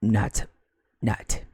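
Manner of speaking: low, clipped one-word verdict. Acted. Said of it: "speaks and goes".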